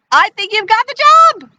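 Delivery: high, loud happy announcement; delighted. Acted. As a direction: announce the good news with a rising thrill. Woman delighted, hires the fox.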